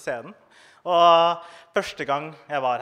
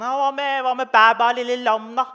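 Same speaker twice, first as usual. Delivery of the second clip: put-on higher, louder eager kid; eager child voice; 8 semitones higher, 7 dB louder than usual. A child keenly suggests a song to the teacher, higher and louder. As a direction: pipe up like a keen pupil.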